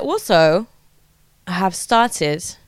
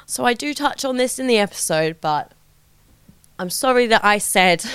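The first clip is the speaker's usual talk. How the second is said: ordinary delivery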